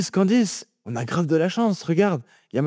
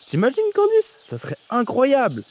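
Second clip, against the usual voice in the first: excited; 6 semitones higher than usual. Acted. Excited about meeting the couple.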